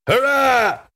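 high-pitched pirate crew hurrah; yelled cheer. A narrator's reading. Pirate crew greeting the lookout's news, high yelled cheer. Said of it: "yelled the crew".

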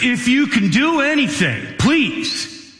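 high, pleading father begging for his son; a father's high pleading cry; desperate plea. Retold or imitated. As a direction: beg for help, voice lifted high. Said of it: "And the man says".

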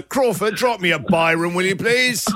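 high, lordly mock request; mock master to butler. Host teasing intern as lord ordering his driver, mock-aristocratic. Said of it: "as your butler?".

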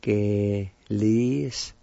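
Geliski drawn out syllable by syllable, very slow. Shown as a demonstration. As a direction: stretch Geliski out slowly for learners. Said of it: "It's pronounced like this".

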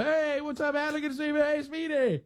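high, bright, eager greetings; host showing how his friends really greet each other; lively greetings. Retold or imitated. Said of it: "we're all in there".

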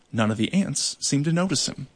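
usual speaking voice

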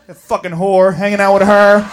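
low, bitter grumble; resentful boyfriend. Acted. Boyfriend grumbles about the friend.

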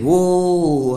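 a drawn-out stage "Whoa" sung into the crowd; singer's mic call. Retold or imitated. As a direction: call out like a showman working the audience. Singer calls at her.

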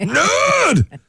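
high teasing yell; mock shout.

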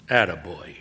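ordinary delivery, nothing marked

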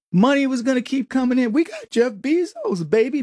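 high, cocky gloating executive voice; smug mockery. Host mocking Post executives bragging about Bezos, high smug gloat. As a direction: lampoon the arrogant bosses crowing about their backer.

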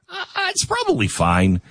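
high-pitched mock nonchalance; dismissive shrug. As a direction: brush it off in a high little voice. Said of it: "be suspicious of the phrase".

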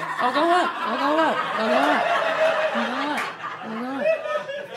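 high sing-song retreating dad; awkward basement dad. Pretend. Dad excusing himself from the sleepover, awkward high sing-song. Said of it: "He'd totally be a basement dad".

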